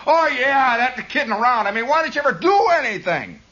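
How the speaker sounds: put-on high-pitched kid challenging Dad; kid talking back.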